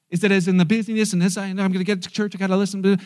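ordinary talk

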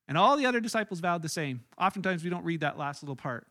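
ordinary talk, nothing marked

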